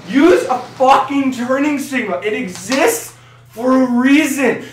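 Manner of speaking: slow, punched-out demand; slow emphatic rant. Acted. Ranting at Ford drivers to signal, slow and emphatic. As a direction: hammer each word slowly. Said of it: "I'm sick and tired of you Ford drivers".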